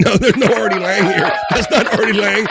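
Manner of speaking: high and loud through laughter; laughing denial. Real, not acted. Laughing denial about lookalike.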